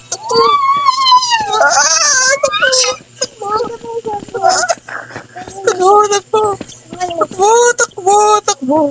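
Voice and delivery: high frantic yelling; panicked shouting. Pretend. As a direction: scream at the kid to run. Fleeing in the game.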